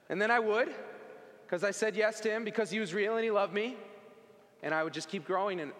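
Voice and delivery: normal talking voice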